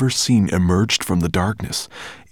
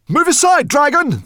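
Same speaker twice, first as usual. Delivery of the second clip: very high, loud urgent call; 6 dB louder than usual. Acted. Man warning the dragon from the cave entrance, loud call. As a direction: shout a warning across the cave. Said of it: "the man called".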